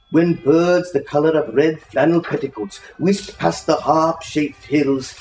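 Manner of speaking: ordinary delivery, nothing marked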